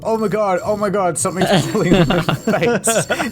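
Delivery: high, panicked, laughing through it; high panicked fish. Pretend. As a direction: shriek in mock terror while hooked.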